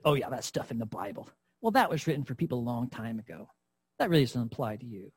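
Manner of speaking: a quick, low scoff; mocking devil. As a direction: brush God's word off like the sneering devil. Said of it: "that mocking voice".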